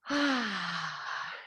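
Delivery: long, soft falling sigh; long exhale. Real, not acted. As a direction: breathe out slowly on "ah". Exhales during exercise.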